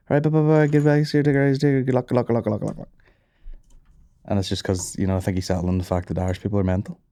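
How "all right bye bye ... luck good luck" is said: fading phone goodbyes. Retold or imitated. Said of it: "on the phone be like".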